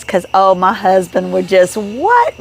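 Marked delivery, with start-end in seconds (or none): 1.8-2.4 high, rising "what" of disbelief; mock amazement, pitched up; amazed. Wife retelling her thought of her husband, amazed. Thinks of husband loving the memorabilia.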